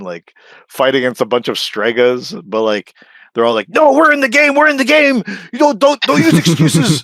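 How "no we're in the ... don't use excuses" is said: high squeaky insistence; mock insistent fans. Mocking fans insisting they played it, high squeaky whine. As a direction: whine it like a stubborn fan.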